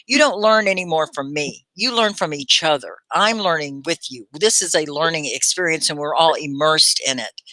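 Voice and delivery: ordinary delivery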